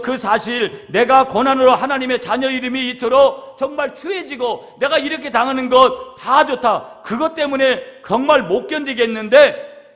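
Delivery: fervent preaching. Acted. Pastor voicing David's anguish to the congregation, fervent. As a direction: plead David's anguish to the church.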